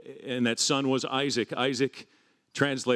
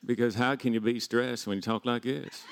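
put-on Southern accent; 6 semitones higher, 4 dB quieter than usual. Preacher shrugging off stress in a Southern accent. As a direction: lay on an easygoing Southern accent. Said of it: "I talk in a Southern accent".

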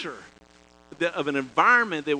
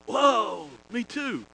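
surprised exclamation; 6 semitones higher, much slower than usual. Retold or imitated. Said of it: "And I'm going".

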